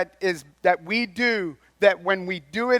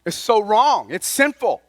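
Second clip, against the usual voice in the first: ordinary delivery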